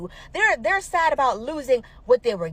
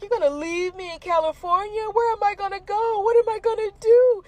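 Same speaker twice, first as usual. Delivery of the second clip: mock crying whine; 8 semitones higher than usual. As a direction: imitate a grown man blubbering. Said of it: "snotty nose crying because he was like".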